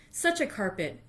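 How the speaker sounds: normal talking voice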